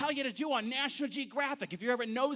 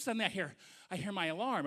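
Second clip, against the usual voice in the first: ordinary talk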